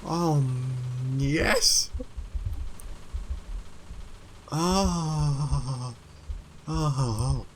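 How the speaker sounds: high, dreamy delight; blissful. Real, not acted. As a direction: savour the win happily. Basking after the success.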